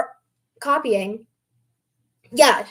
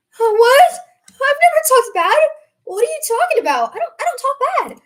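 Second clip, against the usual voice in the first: higher, fast, defensive "huh, what?"; mock shocked denial; 10 semitones higher, 5 dB louder than usual. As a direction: play Aubrey as stunned and protesting. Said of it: "Aubrey's like".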